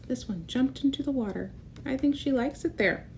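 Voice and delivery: ordinary delivery, nothing marked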